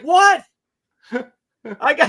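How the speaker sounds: high, loud put-on old mother voice; pushing food like a doting grandmother; hammed-up grandma offering meatballs; mock mother.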